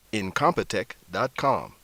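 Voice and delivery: normal talking voice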